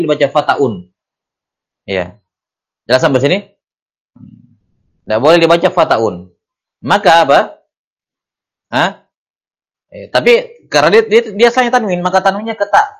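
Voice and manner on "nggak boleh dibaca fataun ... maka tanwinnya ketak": ordinary talk, nothing marked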